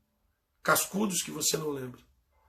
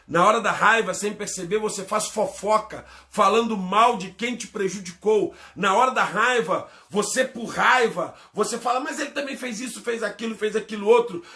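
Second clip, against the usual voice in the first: emphatic, raised pulpit delivery; impassioned preaching; 4 semitones higher, 5 dB louder than usual. Real, not acted. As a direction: build the charges insistently, phrase on phrase. Preaches against gossip. (pregação inflamada)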